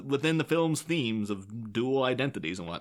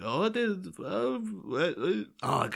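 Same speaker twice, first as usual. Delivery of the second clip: put-on higher, halting stab at a Welsh accent; failed Welsh attempt; 7 semitones higher, 3 dB quieter, much slower than usual. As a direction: grope for a Welsh accent and give up. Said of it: "I just can't".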